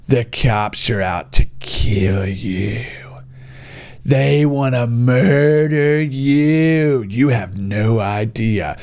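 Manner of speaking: mock fearmongering media whisper; ominous mock whisper. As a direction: hiss it like a scary secret. Mocking media's scary message.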